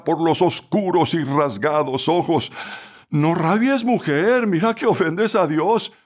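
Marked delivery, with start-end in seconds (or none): 3.1-5.9 high, taunting jeer; heckler's mock reproach from the crowd. A narrator's reading. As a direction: needle her with false piety. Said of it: "profirió alguien burlonamente entre la turba".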